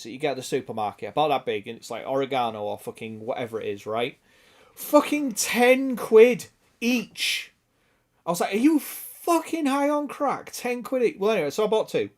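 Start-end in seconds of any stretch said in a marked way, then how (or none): 4.7-7.5 high, very slow, drawn out; outraged disbelief. Real, not acted. Telling the stream what a little spice jar cost, incredulous. 8.5-11.2 high, slowed, indignant question; retold incredulity. Retelling the reaction to the Amazon price, incredulous.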